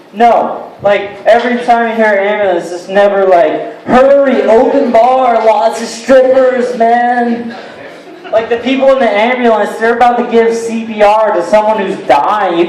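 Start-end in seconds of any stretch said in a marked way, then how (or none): 3.9-7.6 higher, hammed-up party call; mock excitement. Comic imagining a happy ambulance summons, mock excited. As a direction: urge them along like a party's starting.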